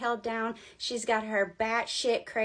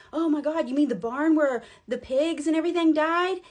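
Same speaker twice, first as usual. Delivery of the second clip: mock shock; 5 semitones higher, 6 dB louder than usual. Acted shocked reply to psychic's news.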